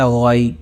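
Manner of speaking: غی with flat, unrounded lips and a full mouth; unrounded demo. Shown as a demonstration. Teacher shows students the normal unrounded غی. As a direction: say غی without rounding the lips. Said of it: "اونٹ گول نہیں کرنے".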